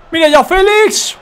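high excited shout; excited call. Real, not acted. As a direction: call out the shot. Long shot attempt.

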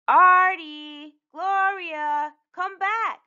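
high, drawn-out call across the yard; sing-out kid's call. A narrator's reading. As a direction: holler for them to return. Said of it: "called Usha".

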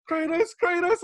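high, loud hyped name call; excited whisper-shout. Retold or imitated. Coworker turns to him, high excited Kratos call. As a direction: blurt the name high and thrilled. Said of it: "he goes".

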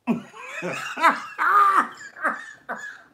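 real laugh climbing very high and loud; shrieking laugh fit. Real, not acted. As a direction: totally lose it, high wheeze.

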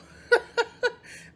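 sudden loud laugh; loud burst of laughter. Real, not acted. Laugh at own tiredness.

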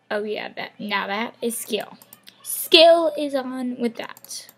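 ordinary delivery

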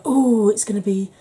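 re-enacted delighted anticipation; excited anticipation. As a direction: relish each colour, slowly. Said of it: "I was like".

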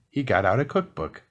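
ordinary delivery, nothing marked

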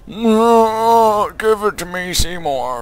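high drawn-out zombie moan; lightly hammed-up zombie plea. Voices a moaning zombie.

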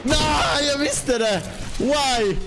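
high-pitched outburst of dismay; dismayed cry. Real, not acted. Gamer sees what he dreaded, high dismayed cry. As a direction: wail it, pitch way up. Player meets feared Aura in game.

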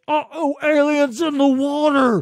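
high, loud mocking shout; over-the-top mocking holler; mock yell. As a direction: blare it out in hammy alarm, stretching PURPLE. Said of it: "and was just like".

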